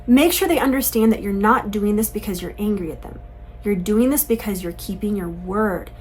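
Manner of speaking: ordinary talk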